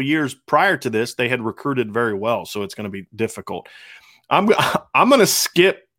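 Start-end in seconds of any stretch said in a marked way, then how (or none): 4.3-5.9 laughing, slow and higher. Real, not acted. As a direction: laugh through the words. Laughing at a question.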